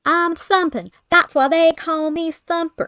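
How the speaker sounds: a hint of cartoon rabbit; Thumper voice. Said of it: "it was for Thumper".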